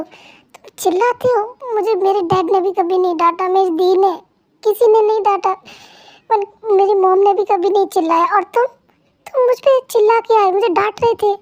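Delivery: hurt accusation. Acted. Wife accuses husband of yelling at her, hurt accusation. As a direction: pour out the wounded grievance.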